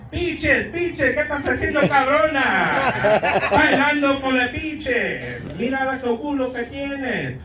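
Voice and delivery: high, slow, hammed-up Spanish dub. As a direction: ham it up like a cheesy dubbed song. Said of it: "it's like".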